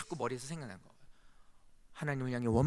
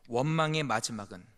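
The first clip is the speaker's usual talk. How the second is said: usual speaking voice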